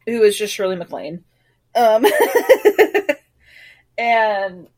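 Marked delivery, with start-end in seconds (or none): 2.1-3.2 high, loud burst of laughter. Real, not acted. Laughing at Shirley MacLaine joke.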